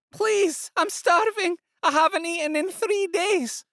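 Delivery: high pleading voice; starving woman pleading with the fishmonger; begging woman. A narrator's reading. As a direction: beg for mercy.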